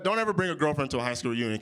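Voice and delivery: ordinary talk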